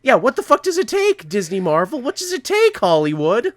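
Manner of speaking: high-pitched exasperated demand. Real, not acted. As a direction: demand answers, voice leaping up in frustration. Exasperated plea to the studios.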